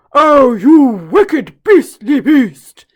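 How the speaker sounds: cried out. Acted. Elephant crying out at the croc, loud and outraged. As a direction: roar the insult slowly. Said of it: "cried Chunky".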